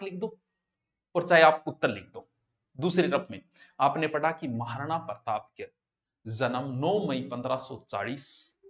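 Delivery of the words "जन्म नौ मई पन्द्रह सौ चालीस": slow, word by word, like dictating a note; dictating at a slow pace; slow dictation. Shown as a demonstration. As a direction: spell the date out slowly for students to copy. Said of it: "इसको दो तरीके से लिखना है आपको".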